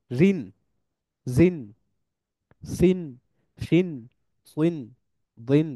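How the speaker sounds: each letter with a clear nasal "in" ending, said one by one; kasratain drill. Shown as a demonstration. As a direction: model each syllable slowly for students to repeat. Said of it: "দুই জের হলে কিরকম হবে?".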